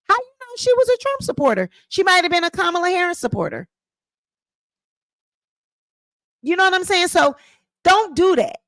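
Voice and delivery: high, scolding disbelief; incredulous scolding. Real, not acted.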